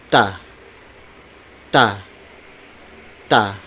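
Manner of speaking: "ta" with no puff of air after T; unaspirated T. Shown as a demonstration. Teacher models the letter T, "ta" without aspiration. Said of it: "It doesn't have that".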